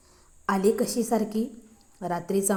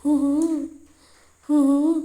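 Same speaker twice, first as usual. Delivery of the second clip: high, loud coy "हुं हुं"; a dainty teasing hum through closed lips; playful little hum from behind the door; delicate hum; 6 semitones higher, 7 dB louder than usual. A narrator's reading. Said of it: "एक नाजूकसं".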